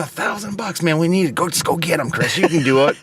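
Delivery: high-pitched mock pep talk; eager urging. Partner pushing Chris to take thousand-dollar job, eager imitation.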